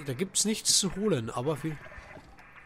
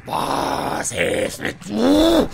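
drawn-out taunt; 3 semitones higher, 10 dB louder, much slower than usual. Pretend. Taunting the monster to come down.

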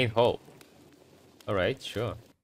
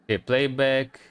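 usual speaking voice

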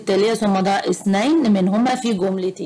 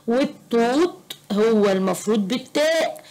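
ordinary delivery

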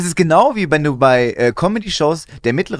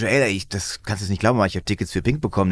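low mock confiding; 9 semitones lower, 5 dB quieter than usual. Imagined friend with [name] tickets.

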